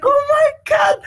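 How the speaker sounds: very high-pitched retold fan scream; mock scream. Gamer re-enacting screaming at the tournament, high mock scream. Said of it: "I was, like, screaming the entire time".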